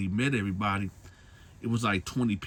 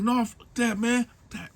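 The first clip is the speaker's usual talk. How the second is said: much higher, agitated swearing; panicked refusal; 13 semitones higher than usual. Retold or imitated. Man refuses the women, swearing high and agitated. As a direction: refuse in high, rattled outrage.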